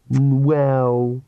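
drawn-in, grudging acceptance; grudging concession. A narrator's reading. Daughter brightening up at her father's words, grudging. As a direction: concede it reluctantly.

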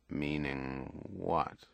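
very slow, low and quiet question; wary, hushed query; cautious. A narrator's reading. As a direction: probe carefully, drawing the words out. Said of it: "He proceeded cautiously".

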